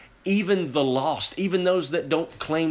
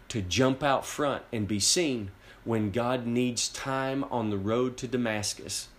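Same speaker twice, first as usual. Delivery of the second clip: usual speaking voice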